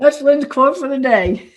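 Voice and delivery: real laugh, loud and high. Real, not acted. Lynn joking with the host about her quote, laughing loudly. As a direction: say it while bursting out laughing.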